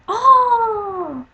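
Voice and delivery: very high, loud startled oh; a girl suddenly grasping why the boys laughed; shocked gasp. Retold or imitated. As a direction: realize it all at once. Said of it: "She's like".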